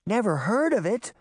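put-on high, incredulous girl's exclamation; exclaiming. A narrator's reading. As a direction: throw it back in disbelief. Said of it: "Daphne exclaimed".

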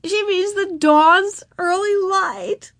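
"dawn's early light" drawn out slowly, each word separate; high, slow over-enunciation of "dawn's early light"; exaggerated distinctness. A narrator's reading. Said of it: "pronounced each word with exaggerated distinctness".

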